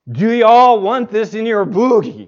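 hammed-up Southern drawl, repeating for the baffled customer. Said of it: "I cannot do the Southern drawl as she did it".

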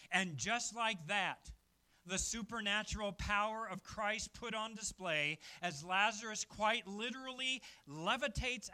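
proclaiming the miracle loudly; preaching at full force. Acted. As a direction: preach it out to the whole church.

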